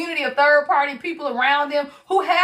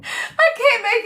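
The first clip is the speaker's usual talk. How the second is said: high, laughing through the words; 8 semitones higher than usual. Real, not acted. Reading yet another card, high laughing voice. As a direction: squeeze the words out while cracking up.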